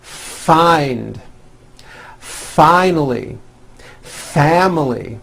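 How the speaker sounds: FIND, FINALLY, FAMILY each with a long airy F; slow model words, F drawn out at the start; stretched F. Shown as a demonstration. Teacher leading word practice, slow stretched F. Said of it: "words beginning with the letter F".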